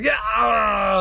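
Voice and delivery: excited high cheering shout; crew cheer. Acted. Crew cheering after the captain's rallying call, a high excited yell. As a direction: shout it like the whole crew celebrating.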